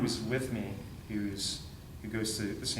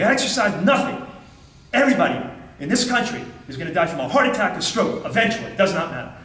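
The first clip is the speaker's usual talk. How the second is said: imitated doctor yelling, much higher and louder; 7 semitones higher, 10 dB louder than usual. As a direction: shout it at him like a furious doctor. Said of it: "And he starts yelling".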